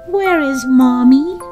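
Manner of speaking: ordinary delivery, nothing marked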